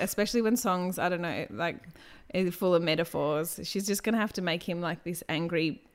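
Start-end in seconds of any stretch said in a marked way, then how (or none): none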